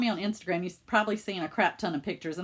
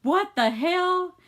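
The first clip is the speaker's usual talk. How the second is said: loud, high, drawn-out disbelief; stunned dad; 11 semitones higher, 6 dB louder, much slower than usual. Retold or imitated. Dad facing daughter at surprise party, mock-stunned. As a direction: stretch it out as a flabbergasted dad. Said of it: "he's just looking at me like".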